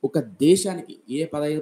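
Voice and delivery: ordinary talk, nothing marked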